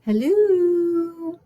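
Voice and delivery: loud, high, long-held hello; calling out. Acted. Mona trying to reach the woman staring through her, calling out. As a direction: sing it out to get through to someone. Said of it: "I tried again".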